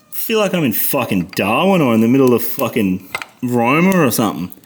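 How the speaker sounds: ordinary talk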